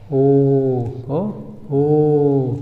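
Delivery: ओ held as a pure vowel; isolated sustained ओ; long o sound. Shown as a demonstration. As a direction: draw ओ out as a demonstration. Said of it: "क्या है?".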